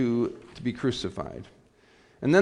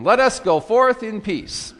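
high, raised-voice dismissal; liturgical proclamation; 8 semitones higher, 5 dB louder than usual. Real, not acted. Worship leader sending the congregation into procession, high ringing proclamation. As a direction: call it out to the whole church.

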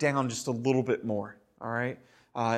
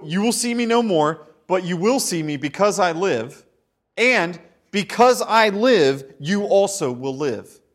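louder, ringing proclamation; preaching with force; 5 semitones higher, 9 dB louder than usual. Acted. Preacher proclaiming the verse loudly to the congregation. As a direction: proclaim it to the back row.

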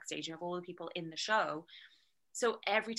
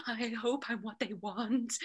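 gasp, then timid, slower pleading; mock nervous hopeful; 3 semitones higher than usual. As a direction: send up a needy auditioner. Mocks a needy, nervous auditioner.

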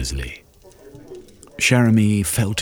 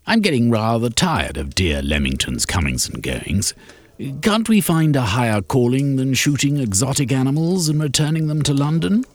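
rising, lightly mocking toff; Shermy's weary disdain; 9 semitones higher than usual. Shermy mocks the explorer's exploits at dinner, high weary disdain. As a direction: sound fed up and superior.

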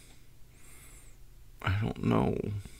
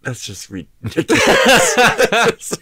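slow, laughing disbelief; 13 semitones higher, 4 dB louder, much slower than usual. Real, not acted. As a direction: laugh through it, incredulous.

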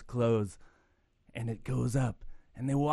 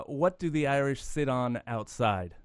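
normal talking voice

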